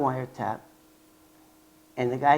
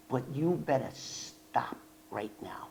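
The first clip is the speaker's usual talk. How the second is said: slow, soft, unvoiced threat; whispered warning; 4 semitones lower, 12 dB quieter than usual. Agent threatening the informant on the phone, in a whisper. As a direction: hiss the warning low and slow.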